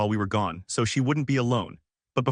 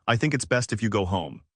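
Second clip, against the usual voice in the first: usual speaking voice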